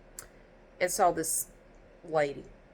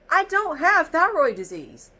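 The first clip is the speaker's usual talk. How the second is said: raised, higher, slow protest; exasperated protest; 9 semitones higher, 7 dB louder, slower than usual. Retold or imitated. To the doctor, an exasperated raised protest. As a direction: insist it slowly and loudly.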